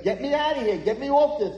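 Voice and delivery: high, loud acted demand; frantic boy. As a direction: yell to be let go. Boy protests in operating room.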